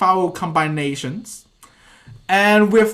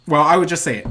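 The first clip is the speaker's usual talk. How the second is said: usual speaking voice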